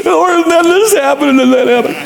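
very high, wailing cry-talk; mock sobbing prayer. Man crying to the Lord in his shop, exaggerated sobbing. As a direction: blubber it out, hammed-up crying. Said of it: "I just need to cry".

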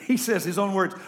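usual speaking voice

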